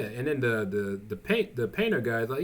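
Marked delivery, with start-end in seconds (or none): none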